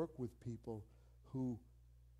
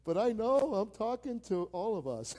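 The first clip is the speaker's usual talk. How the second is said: mock disbelief; 8 semitones higher, 9 dB louder than usual. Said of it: "Some of you are like".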